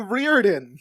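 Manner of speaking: Riordan said "REER-din"; alternative pronunciation. Retold or imitated. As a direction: loudly say Riordan with a first syllable like "rear". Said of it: "a clip of him saying".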